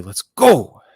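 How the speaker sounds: loud, high hype shout of let's go; excited cheer. Real, not acted. Streamer cheering at a new drop, loud and excited. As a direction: cheer it out like the drop just landed.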